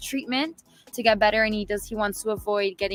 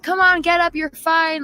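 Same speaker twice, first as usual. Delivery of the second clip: high, loud imitation of a crowd; raised, insistent mock crowd shout; crowd urging; 8 semitones higher, 8 dB louder than usual. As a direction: call out like fans urging a player up. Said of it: "the crowd was like".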